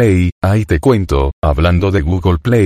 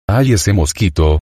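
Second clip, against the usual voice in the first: ordinary talk, nothing marked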